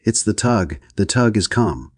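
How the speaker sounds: mild scream. A narrator's reading. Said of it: "screamed down the companionway".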